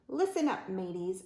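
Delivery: usual speaking voice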